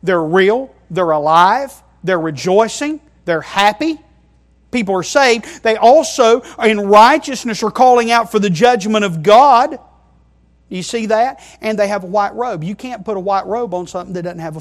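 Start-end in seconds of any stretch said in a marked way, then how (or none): 4.7-9.8 raised, loud pulpit proclamation; emphatic preacher in full flow; forceful preaching. Real, not acted. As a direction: build it up and proclaim it to the congregation.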